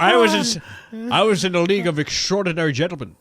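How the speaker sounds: mock Scottish film star with slushy S's; Sean Connery. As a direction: play the Scottish star recalling his films. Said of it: "do a Sean Connery voice for us".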